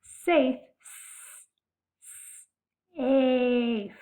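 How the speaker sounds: slow, drawn-out sounding of safe; stretched-out word. Shown as a demonstration. Teacher to young pupils, stretching out a word slowly. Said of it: "So when I stretch out".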